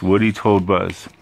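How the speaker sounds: ordinary delivery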